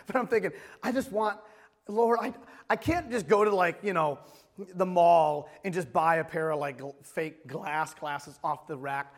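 ordinary delivery

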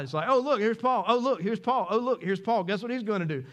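loud, high, driving sermon voice; full-force preaching. Acted. Pastor preaching to the congregation, loud and driving.

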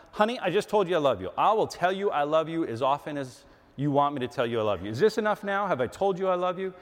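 acted husband at the end of his patience; exasperated husband. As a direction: argue back with mounting irritation. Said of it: "without the emotion involved that, that you can hear in my voice".

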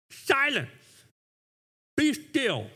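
retold rebuke. Preacher voices Jesus rebuking wind and waves, firm rebuke. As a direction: rebuke it in two short, separated commands. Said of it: "he rebuked the wind".

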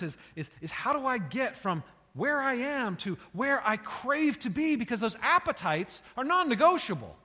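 rising fervent sermon delivery; passionate preaching. Acted. Preacher builds to a passionate climax.

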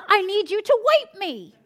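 put-on little boy. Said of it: "And he says".

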